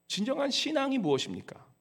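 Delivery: normal talking voice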